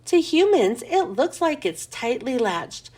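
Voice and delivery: ordinary delivery, nothing marked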